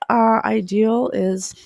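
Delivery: usual speaking voice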